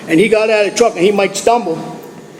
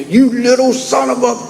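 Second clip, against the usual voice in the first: higher, drawn-up mock yell of an angry dad; mimicked parental tirade, pitched up; retold screaming father; 6 semitones higher than usual. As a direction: imitate a furious father without full volume. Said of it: "that's what he's screaming at me".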